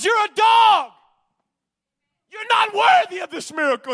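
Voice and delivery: high, loud mock rebuke as Jesus; shouted rebuke. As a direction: fling the insult out, shouting. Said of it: "In other words".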